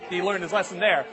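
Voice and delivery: usual speaking voice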